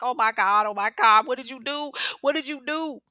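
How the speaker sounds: loud, high frantic cries; panicked screaming. Retold or imitated. The cousin screaming at Tasha after the shooting, panicked. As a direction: panic at what was done. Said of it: "Cuzzo's screaming and carrying on".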